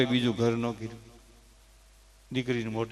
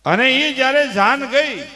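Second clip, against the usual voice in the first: loud, high, swelling sermon cry; impassioned preaching; 9 semitones higher, 7 dB louder than usual. Acted. Preacher telling listeners why daughters' farewells bring tears, impassioned. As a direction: lift it like a preacher moving the crowd.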